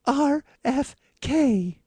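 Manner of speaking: high, slow breathy starlet; Marilyn voice. Acted. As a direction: coo the joke like a starlet. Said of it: "Marilyn Monroe's voice".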